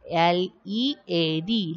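letter by letter, evenly spaced; spelling out letters. Shown as a demonstration. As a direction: spell each letter out separately.

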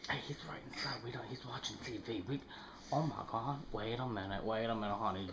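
low, hushed scheming voice. Real, not acted. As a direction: keep it down so he won't hear. He's right inside, keep voices down.

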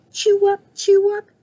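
very high, peppy chirp; chirping cricket. A narrator's reading. Cricket popping up to join the band, high chirp. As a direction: chirp it in a tiny squeaky voice. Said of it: "chirped Cricket".

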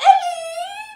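very high, loud squeal of hello; loud cheery greeting. Acted. Old lady surprising Ellie, shrieking hello. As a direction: burst in shrieking her name. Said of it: "an old lady came by and said".

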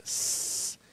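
"S" as a long voiceless hiss, no vowel; snake hiss. Shown as a demonstration. Teacher giving learners the first sound, long snake-like "S". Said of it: "este sonido de la serpiente".

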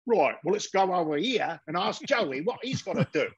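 mock a failed New York accent that comes out Cockney. British actors playing New York gamblers, broad Cockney accent.

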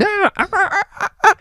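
high loud cawing like a crow; shrill mock crow cries. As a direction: squawk like a frantic crow. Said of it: "this crow would be going".

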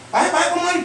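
mock posing guy. Guy poses a third time.

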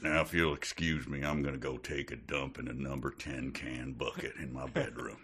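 deadpan the folksy uncle; Texas drawl. Pretend. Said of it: "slow Texas drawl".

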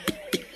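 whispered little pick pick; soft pecking sounds. A narrator's reading. Narrator imitates pecking birds.

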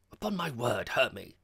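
loud, mildly vexed reproach. A narrator's reading. Georgie rebukes his laughing visitor, very much vexed. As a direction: scold with affronted dignity. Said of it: "Georgie was very much vexed".

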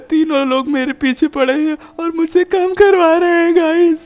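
whiny complaint building to a wail; mock whine. Captive complains to viewers.

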